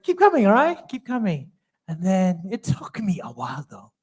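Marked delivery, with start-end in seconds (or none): 0.0-1.5 high mock echo of the leader's line; mocking the brush-off. Repeating the worship leader's stalling, mocking and high. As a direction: parrot the leader's brush-off, much higher. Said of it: "And it's like".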